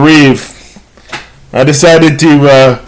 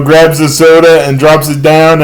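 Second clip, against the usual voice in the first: ordinary delivery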